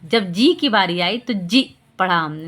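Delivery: ordinary delivery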